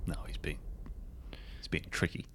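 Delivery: whispered aside. Acted. Ernest confiding about the supercomputer, whispered. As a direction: hush it like a secret.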